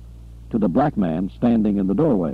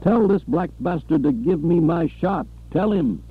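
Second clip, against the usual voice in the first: screaming; 7 semitones higher than usual. A narrator's reading. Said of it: "Butler screamed".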